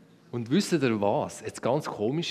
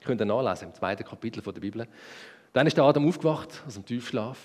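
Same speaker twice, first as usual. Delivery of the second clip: ordinary talk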